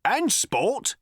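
loud interjection. A narrator's reading. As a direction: blurt it over the others. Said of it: "said another, loudly".

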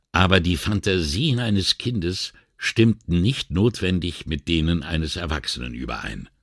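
usual speaking voice

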